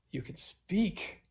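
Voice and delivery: slow, high, awed; amazed. Acted. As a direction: marvel at it slowly. Tree speaks suddenly.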